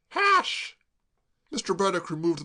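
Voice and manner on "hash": put-on much higher, slow wretched girl; tearful girl. Claire sobbing to Braddock, high tearful wail.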